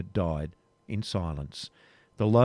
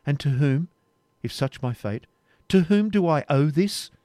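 rising, higher indignant challenge; indignant question; 6 semitones higher, 5 dB louder than usual. Acted. Rebecca confronting the Templar about her fate, rising indignation. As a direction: demand who is to blame.

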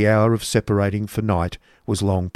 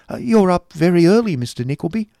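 high chirpy spinster voice; fussy Miss La Creevy; 9 semitones higher, 4 dB louder than usual. A narrator's reading. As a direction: chirp at the early visitor.

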